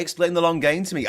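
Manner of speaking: ordinary delivery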